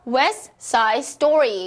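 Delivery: "West Side Story" as wes-sai-story, no T in West; dropped T. Shown as a demonstration. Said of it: "생략을 해주셨습니다". ("West Side Story"에서 West의 T를 생략)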